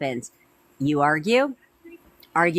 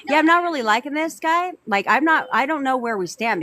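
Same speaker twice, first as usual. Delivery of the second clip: put-on unsure girlfriend; 5 semitones higher than usual. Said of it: "And now you're like".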